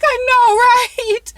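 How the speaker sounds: high, loud I know with a laugh on right; laughing agreement. Retold or imitated. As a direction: cackle your agreement. Agreeing with a tweet.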